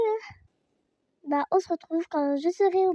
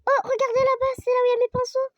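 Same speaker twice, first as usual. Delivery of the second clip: loud, high, thrilled call; excited shout; 8 semitones higher, 8 dB louder, faster than usual. Real, not acted. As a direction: shout it out with delight. (cri enthousiaste, plus aigu)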